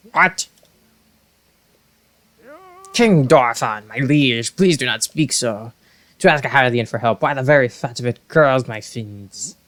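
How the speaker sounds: lightly voiced grumpy old advisor; a hint of a prickly Zora elder; indignant elder Muzu. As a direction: protest in offended disbelief.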